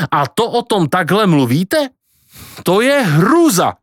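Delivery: high, horrified protest; appalled exclamation. Acted. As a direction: cry out in shock, pitch right up. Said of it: "volal Pako". (zhrozené zvolání)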